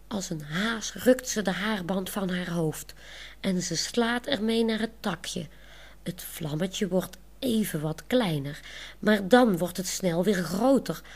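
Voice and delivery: ordinary delivery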